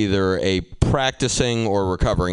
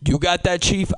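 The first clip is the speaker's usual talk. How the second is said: sudden higher, punchy jab; mock tough challenge; 11 semitones higher than usual. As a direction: bark the challenge up high. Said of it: "that's from Good Will Hunting".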